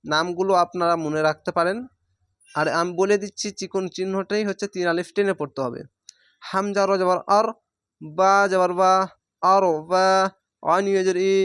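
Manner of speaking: ordinary talk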